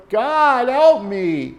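high, desperate cry to God. Retold or imitated. David crying out loud to God for help, high plea. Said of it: "It's not just metaphorical. David went".

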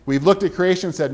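usual speaking voice

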